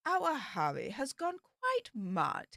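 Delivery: slow, soft, plummy Queen's English impression; posh Queen's English. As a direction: put on the Queen's English, slowly and softly. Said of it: "I'll try and do the Queen's English".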